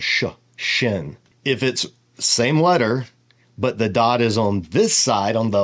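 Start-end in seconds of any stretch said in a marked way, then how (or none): 0.0-1.2 "sh, shin" with a drawn-out hushing sh first; sh sound demo. Shown as a demonstration. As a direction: hold the "sh" then say "shin". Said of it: "It makes the S H sound".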